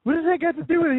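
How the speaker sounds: high, incredulous questioning; baffled protest. Retold or imitated. Retelling his reaction to his coworker, high and baffled. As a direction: ask it as if it makes no sense at all.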